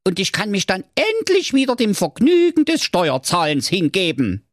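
high, pompous little king calling out; King Curry. A narrator's reading. Currywurst king calling Thorsten over on the steps, high and bossy.

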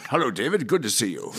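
Welsh singer's greeting, lightly put on. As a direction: greet someone in an imitated Welsh voice. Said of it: "He talks like this all the time".

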